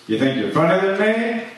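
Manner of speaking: taunting rival comic, half in jest; mock challenge. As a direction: hurl the challenge at the crowd. Mock heckler challenge, climbing.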